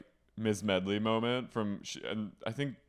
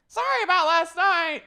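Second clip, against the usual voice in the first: very high, loud fake whine; mock wailing apology; 10 dB louder than usual. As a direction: cry it out as a hammed-up impression. Imitating Brandy's tearful morning apology.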